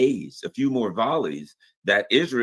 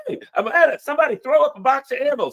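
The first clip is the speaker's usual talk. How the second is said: mock call for ammo; 11 semitones higher, 6 dB louder, faster than usual. Soldier calling in firefight.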